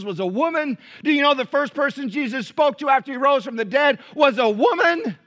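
loud, ringing preacher's challenge; full-force preaching. Acted. Preacher challenges the church about women, loud and ringing. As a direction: hammer the question home to the congregation.

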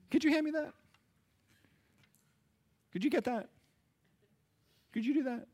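very high mock-helpless request; lazy person's wheedling. Lazy person asking others to fetch things, high mock wheedling. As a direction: put on a high little pleading voice.

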